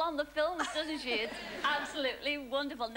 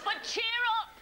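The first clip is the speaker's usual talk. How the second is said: higher, louder rallying call to the crowd; cheer up call; 8 semitones higher, 3 dB louder than usual. Real, not acted. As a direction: lift the audience with a bright call. Host rallying the audience.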